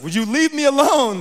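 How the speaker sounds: loud, high fed-up cry; exasperated plea. Retold or imitated. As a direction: shove the pest away with your voice. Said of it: "He's like".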